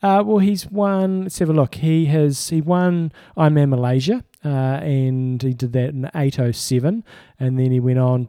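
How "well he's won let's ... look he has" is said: usual speaking voice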